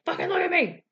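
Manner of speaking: hammed-up angry chef bark; Gordon yelling.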